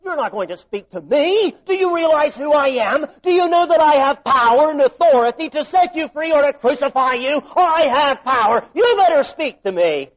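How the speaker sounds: loud, high, furious threat; angry Pilate. Retold or imitated. Preacher voicing Pilate threatening Jesus angrily. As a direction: bellow his power at the silent prisoner. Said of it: "To me is emphatic in the Greek".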